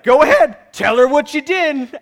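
loud, high, acted parental order; booming mock-dad command; stern father. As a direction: push the child to confess, forcefully. Dad ordering boy to confess.

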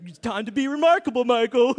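high, loud mocking singsong; mock the voice in your head, loud and high. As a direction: pastor mocking the message he must preach. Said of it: "I was like".

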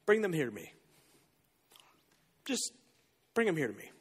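gentle invitation. Acted. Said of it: "Jesus goes".

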